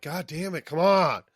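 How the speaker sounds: mock exasperated outburst; impatient. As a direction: hurry them along, playfully fed up. Acted impatience at slow scene.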